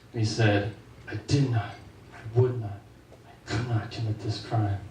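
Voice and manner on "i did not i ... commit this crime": solemn denial. Retold or imitated. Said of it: "standing in front of a jury".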